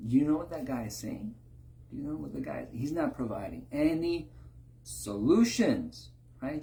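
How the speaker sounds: ordinary talk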